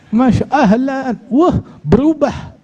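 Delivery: high, slow astonished exclamation; amazed. Acted. Husband amazed at his changed wife, high and slow. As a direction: marvel in disbelief.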